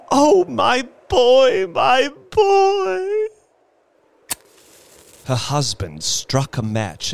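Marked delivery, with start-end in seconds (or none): 0.1-3.3 very high, loud slow wail; anguished crying. Acted. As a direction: wail for her lost son. Grieving mother crying for son.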